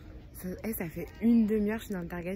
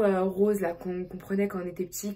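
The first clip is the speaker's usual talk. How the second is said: ordinary talk, nothing marked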